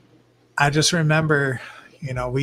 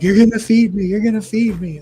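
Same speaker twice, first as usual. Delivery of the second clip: begging fish; 8 semitones higher, 8 dB louder than usual. Pretend. Voicing fish begging at glass.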